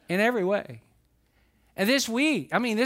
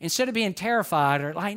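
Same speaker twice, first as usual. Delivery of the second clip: ordinary talk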